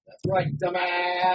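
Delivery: mock catchphrase taunt. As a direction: draw it out slowly like a catchphrase. Hammed-up catchphrase both men echo.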